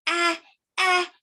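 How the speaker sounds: lightly voiced reaching baby. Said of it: "like baby A".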